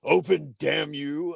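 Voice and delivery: loud commanding robot leader; Optimus Prime. Retold or imitated. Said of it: "the part where he curses".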